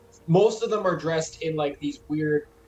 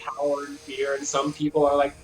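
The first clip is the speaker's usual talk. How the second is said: ordinary delivery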